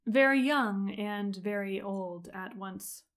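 usual speaking voice

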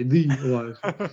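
mock correction with "the" as a long "thee"; stress on THE. Host imitating people who correct everybody, "the" stressed as "thee". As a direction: hit "the" as "thee", imitating a pedant. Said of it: "when they correct everybody, say".

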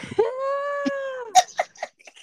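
shrieking burst of laughter; real high cackle. Real, not acted. Laughs at own story.

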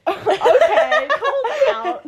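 high, loud, drawn-out laughing reply; laughing protest. Real, not acted. Friend teased by co-host, laughing loudly in protest. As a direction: crack up at being called out.

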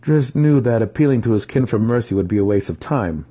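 usual speaking voice

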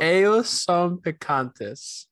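slow, careful "Ayo son picantes"; attempted Spanish. Shown as a demonstration. Co-host trying the Spanish sponsor line, slow and careful. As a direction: sound out "Ayo son picantes" slowly and high. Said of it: "I don't remember how to say it".

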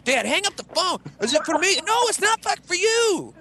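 high squabbling voices; mock phone quarrel. Son acting dad and himself fighting over the phone, high. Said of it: "It's like".

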